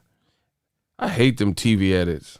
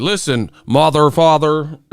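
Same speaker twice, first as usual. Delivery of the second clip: cheesy dubbed-over line; mock TV dub voice; 5 semitones higher than usual. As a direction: ham up a clumsy TV edit voiceover. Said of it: "They'd be like".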